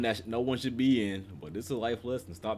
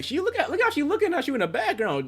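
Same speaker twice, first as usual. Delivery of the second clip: excited squeal, much higher and louder; 6 dB louder than usual. Real, not acted. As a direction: point it out in a high excited pitch. Excited reaction to the scene.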